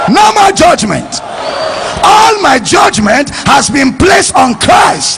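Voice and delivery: rejoicing shout. Shown as a demonstration. Preacher stirring the congregation to rejoice, rejoicing shout. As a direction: shout it out slowly in high-pitched rejoicing. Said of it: "somebody with a rejoicing voice was shouting".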